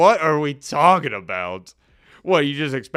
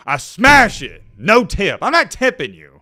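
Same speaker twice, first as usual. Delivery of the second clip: louder, punchy declaration; defiant loud refusal; 4 semitones higher, 9 dB louder, slower than usual. Real, not acted. Host describing refusing to tip, loud and defiant. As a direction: slam the no-tip button proudly.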